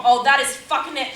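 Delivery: loud, fed-up snap; she's had enough; angry outburst. Acted. Joan loses her temper.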